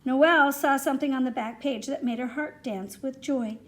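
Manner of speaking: ordinary delivery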